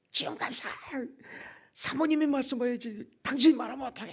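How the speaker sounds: high-pitched mock reprimand; imitated scolding. Others scolding staffer for telling, high imitated reproach. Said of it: "막 실컷 혼났죠".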